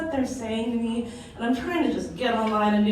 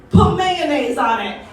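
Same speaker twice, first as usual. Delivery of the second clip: louder, slow, higher call; yelled advice; 6 semitones higher, 6 dB louder, slower than usual. Retold or imitated. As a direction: yell the remedy across the call. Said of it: "someone yells".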